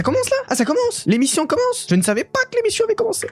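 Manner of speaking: squeaky hammed-up surprise; mock surprise. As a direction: play it up as if caught off guard. Mock surprise at the show starting.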